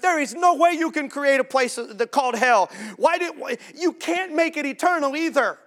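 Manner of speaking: high, loud, whining protest; squeaky mock whine; whiny complainer. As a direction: mimic a petulant critic of God. Mock-whining complaints against God.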